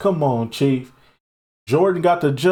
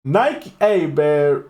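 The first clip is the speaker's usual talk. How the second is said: usual speaking voice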